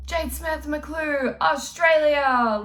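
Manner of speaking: slow, drawn-out, high announcing call; pageant intro call. Shown as a demonstration. Pageant girl at the mic, slow high proud announcement. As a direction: belt your name and country to the judges. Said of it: "they're like".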